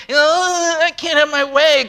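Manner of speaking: mock Karen. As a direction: poke fun at an entitled whiner. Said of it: "God's not Karen".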